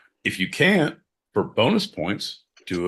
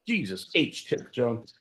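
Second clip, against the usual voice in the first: ordinary talk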